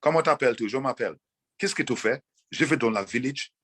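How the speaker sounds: lightly French-flavoured phrasing; French phrases. Shown as a demonstration. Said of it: "learn like a French and say".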